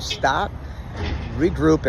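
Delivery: ordinary talk, nothing marked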